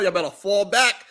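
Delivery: ordinary delivery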